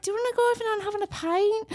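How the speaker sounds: high Scouse pub talk; Liverpool accent. Pretend. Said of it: "we talk like we're, like, from the Liverpool".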